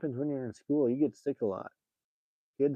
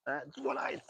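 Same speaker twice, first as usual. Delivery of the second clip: ordinary talk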